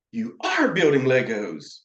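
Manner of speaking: ARE punched high, then falling; stress on ARE. Shown as a demonstration. Counselor reflecting to a child, ARE emphasized. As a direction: hit ARE hard in "You are building Legos". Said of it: "you can emphasize the R".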